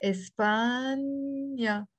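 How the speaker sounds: "España" very slowly, stretching the middle "paaan"; slow teacher's model of España; drawn-out demonstration. Shown as a demonstration. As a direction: hold the long a in España before the last syllable. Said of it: "we will pronounce it".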